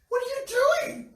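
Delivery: high, loud shocked cry; shocked shout. Retold or imitated. Boyfriend shouting at girlfriend wasting meat, shocked high cry. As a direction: yelp it out in disbelief.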